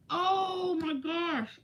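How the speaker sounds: high, slow, loud "oh my gosh"; realizing she lied to her viewers, high and drawn out; shocked realization. Real, not acted. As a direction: gasp it out as it dawns on you. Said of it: "I totally lied!".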